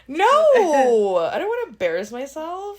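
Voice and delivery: high, loud drawn-out refusal; real embarrassed protest, pitched high. Real, not acted. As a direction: balk at being put on the spot. Refuses a request to sing.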